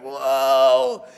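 put-on breathy child's awe; a lightly voiced awed boy; amazed little boy. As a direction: gasp it like a wide-eyed kid. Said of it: "He was like".

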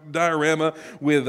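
normal talking voice

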